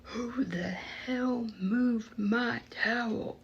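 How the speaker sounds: slow, drawn-out angry holler from down the hall; mock booming yell. Towel owner yelling down the hall, mock booming. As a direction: boom it like an angry inmate. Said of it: "I hear this booming".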